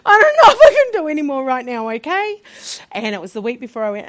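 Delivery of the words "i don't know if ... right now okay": real crying, pitch way up and loud. Real, not acted. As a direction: voice cracking, loud, high and tearful. Breaking down mid-talk.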